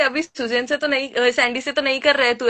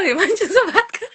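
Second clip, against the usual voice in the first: laughing fangirl mimic; 8 semitones higher than usual. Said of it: "लड़कियां हो गई है पागल".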